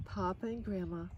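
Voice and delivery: plain reading voice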